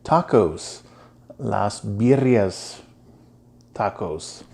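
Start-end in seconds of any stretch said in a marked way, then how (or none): 1.4-4.4 slow, careful Spanish in a heavy American gringo accent; self-conscious gringo accent on Las Birrias. Real, not acted. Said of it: "I have a terrible gringo accent".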